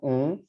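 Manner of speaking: ओं held as a nasal vowel; nasalised vowel. Shown as a demonstration. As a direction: say ओं through the nose, lips not closing. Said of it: "नाक पे, नाक के". (ओं, म के बिना नाक की आवाज़ में)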